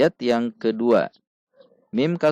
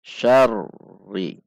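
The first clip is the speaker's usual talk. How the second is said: "syarri" dengan tekanan pada r ganda; ada tekanan; 5 dB louder than usual. Shown as a demonstration. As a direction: tekan r lalu naik ke "ri" dalam "syarri". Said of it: "Ada tekanan". (r tasydid "syarri" ditekan)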